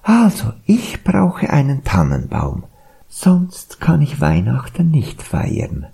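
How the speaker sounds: high-pitched fable deer; high animal-character voice. A narrator's reading. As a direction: speak up high as the little deer.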